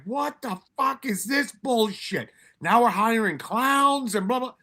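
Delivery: high, slow, disbelieving drill-instructor scoff; mock scoffing instructor. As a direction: ham up the old Ranger's disgust, drawn out. Retold scoffing Ranger instructor.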